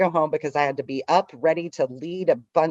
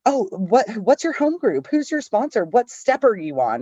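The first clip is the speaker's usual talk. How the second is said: eager questions; 7 semitones higher than usual. Retold or imitated. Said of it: "she was like".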